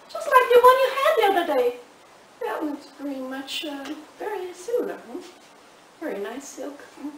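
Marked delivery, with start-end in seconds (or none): 0.1-1.8 fast, loud, high; accusing. Acted. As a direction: fire it off as an accusation. Wife accuses therapist again.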